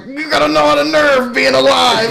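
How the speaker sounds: high, loud indignant yell; retold pissed off. Yelling at brother for surviving the wreck, retold as pissed off.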